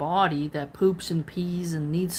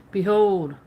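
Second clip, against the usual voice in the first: ordinary talk, nothing marked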